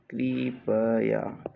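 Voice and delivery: slow, stretched कृपया; drawn-out syllables. Shown as a demonstration. Teacher dictating to students, कृपया slowly in syllables. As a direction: sound out कृपया bit by bit.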